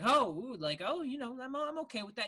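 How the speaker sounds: high, lilting put-on voice; imitated woman. Podcaster voices woman in film scene, high mimicry.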